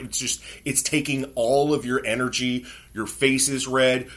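ordinary talk

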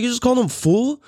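wife asks in mock shock why he said fool; mock shocked mom. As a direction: play her disbelief at the word. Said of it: "She's like".

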